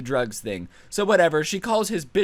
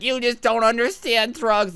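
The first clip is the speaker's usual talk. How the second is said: higher, slow, snooty commenter voice; mock know-it-all drawl; 7 semitones higher than usual. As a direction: parody a smug online critic. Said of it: "anyone in the comments is like".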